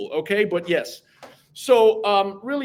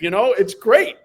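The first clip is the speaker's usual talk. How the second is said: normal talking voice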